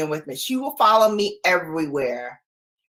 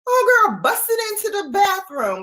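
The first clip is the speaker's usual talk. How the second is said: excited retelling; 13 semitones higher than usual. Real, not acted.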